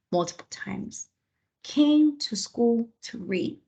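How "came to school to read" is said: put-on slow, clearly spaced words, dictating to children; slow dictation. Shown as a demonstration. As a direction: read each word slowly for young writers. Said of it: "I like to slow it down too".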